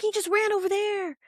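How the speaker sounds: playfully squeaky cover-up; quick excuse. Retold or imitated. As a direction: blurt it out high, covering. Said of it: "He's like".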